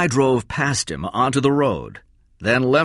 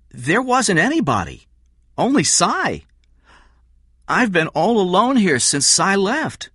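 put-on higher young voice, insistent; boy's voice; 9 semitones higher, 3 dB louder than usual. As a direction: Jeff covers for Kathy, boyish and insistent. Jeff lies to police.

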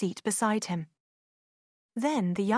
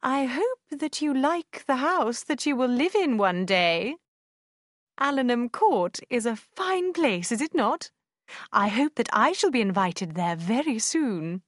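put-on teasing old lady; 3 semitones higher, 3 dB louder than usual. A narrator's reading. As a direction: tease her with sly slowness.

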